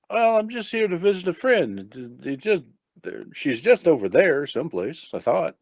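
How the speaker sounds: high-pitched, hesitant explanation; innocent blind visitor. Acted. Blind man explains visit to officer, innocently confused.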